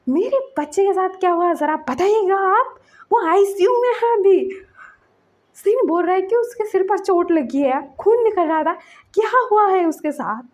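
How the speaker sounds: high and loud, demanding answers; retold furious parent; angry shout. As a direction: yell at the teacher for what happened. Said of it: "चिल्लाता है teacher के ऊपर".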